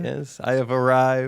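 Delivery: slow grand arrival voice; impersonating Leo. Retold or imitated. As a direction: announce yourself slowly and grandly. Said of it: "good impersonation of Leo".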